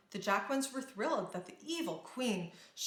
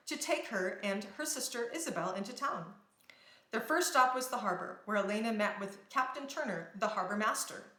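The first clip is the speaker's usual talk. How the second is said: ordinary delivery, nothing marked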